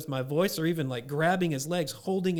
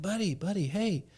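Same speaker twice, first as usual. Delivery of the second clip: soft, hushed reassurance; father calmly talking his son down; gentle dad; 4 semitones higher, 4 dB quieter than usual. Retold or imitated. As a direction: coax the child quietly. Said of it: "It's like".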